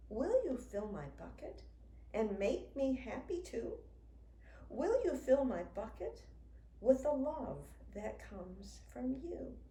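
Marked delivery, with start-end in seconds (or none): none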